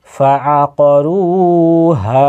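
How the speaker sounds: রু and হা held long, ফা আ ক kept short; drawn-out vowels. Shown as a demonstration. Teacher showing two madds, রু and হা drawn out. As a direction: stretch only রু and হা. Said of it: "হায়ে আলিফ মাদ্দা যুক্ত রয়েছে".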